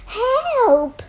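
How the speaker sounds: high panicked shout. Acted. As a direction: cry out for help. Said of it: "they all shouted".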